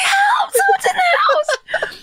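high cracking half-shout, slow and laughing; desperate hushed scream for help, hammed up; whisper yelling. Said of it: "Whisper yelling is the saddest".

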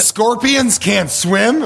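slow, rising "Scorpions can't swim?" with mock disbelief; surprised question. Co-host interrupting the parable, slow disbelieving rise. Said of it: "What if that was all I took from it".

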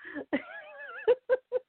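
bursting into a high, breathy laugh; a real laugh that cracks high. Real, not acted. Laughing at how yesterday's morning started, real high laugh.

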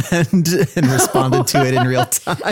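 words bubbling through real laughter; laughing. Real, not acted. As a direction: laugh as you say it, remembering the candy. Laughing recalling eating Turkish Delight.

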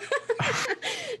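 a sudden high burst of laughter; real laugh. Real, not acted. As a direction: break into a high, helpless laugh. Laughs at friend's ghost joke.

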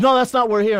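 loud, high mock protest; retold angry outburst.

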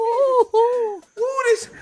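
high, loud teasing squeal; mock squeal. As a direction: squeal it out, mocking the rivals. Mocking gleeful squeal.

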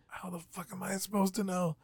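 quiet panicked answer; hushed. Retold or imitated. Whispered reply in ring.

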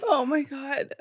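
soft, spent murmur; quiet after hysterics; worn out from laugh-crying. Real, not acted. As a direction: say it weakly, nearly out of voice. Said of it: "I'm literally crying".

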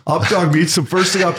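normal talking voice

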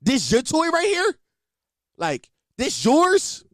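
high-pitched fake disbelief; playing amazed for a kid; mock amazement. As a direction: ask it as if astounded, rising high. Feigned awe at nephew's toy.

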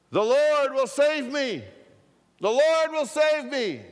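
high, loud shout to a helicopter. Acted. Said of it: "He shouted out to the helicopter".

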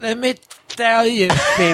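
a light Trump voice, high and stoned; Donald Trump impression. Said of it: "You gotta do high Trump".

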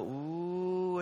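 long drawn-out, hopeful ooh; stretched-out ooh of anticipation; eager crowd "ooh". Retold or imitated. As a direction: mimic a hungry crowd seeing food arrive. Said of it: "everyone would go".

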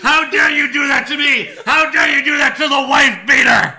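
high, loud mock rage shout; raging gamer. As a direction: scream it like a wrestling promo. Imitating wrestler's screaming promo.

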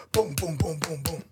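string of vocal thumps; imitated window pounding. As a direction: mimic a rapid fist banging on glass. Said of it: "he pounds on the window".

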